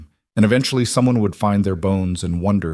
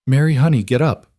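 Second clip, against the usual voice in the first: urgent, louder pleading; 5 semitones higher, 4 dB louder than usual. A narrator's reading. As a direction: beg her to get up. Mother pleading with fallen daughter.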